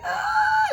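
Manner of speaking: loud, very high mock wail; imitated crying wail. Daughter in labor to her mother, hammed-up crying. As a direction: cry out in an exaggerated sob. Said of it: "like, crying, right?".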